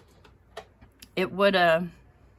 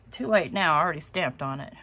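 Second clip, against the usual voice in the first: ordinary talk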